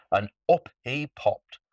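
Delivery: ordinary delivery, nothing marked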